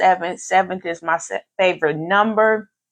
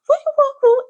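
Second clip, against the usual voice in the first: high, loud [name] hype shout; 5 dB louder, much faster than usual. Retold or imitated. Imitating [name] on her posts, loud hype cries. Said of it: "in my D J Khaled voice".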